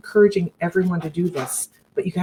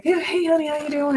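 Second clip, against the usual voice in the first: high-pitched imitation of a friendly lady; chirpy greeting; 11 semitones higher than usual. Lady comes up to greet a nervous woman, chirpy imitation. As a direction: imitate her sing-out hello, pitched way up.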